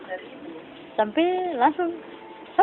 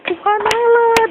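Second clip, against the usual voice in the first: high, loud cry to God; retold cry out; 9 semitones higher, 5 dB louder, much slower than usual. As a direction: call out, stretching the last word. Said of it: "Langsung dengan sahabat teriak". (seruan nyaring memanggil Tuhan)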